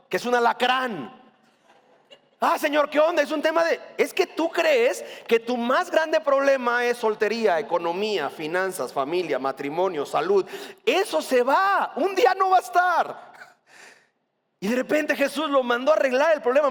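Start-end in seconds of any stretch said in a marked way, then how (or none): 2.4-3.2 high, exasperated whine to God; mock complaint. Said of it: "te manda a un varón". 10.8-13.3 emphatic. Real, not acted. Stressing that problems pass.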